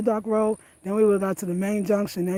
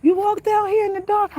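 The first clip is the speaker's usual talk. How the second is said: husband quoted; 13 semitones higher, 5 dB louder than usual. Retold or imitated. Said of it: "He's like".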